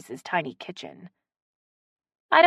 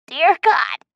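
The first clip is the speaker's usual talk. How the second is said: higher, louder rasp after a bad taste; 11 semitones higher, 9 dB louder than usual. A narrator's reading. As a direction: choke it out raspy. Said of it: "Betsy rasped".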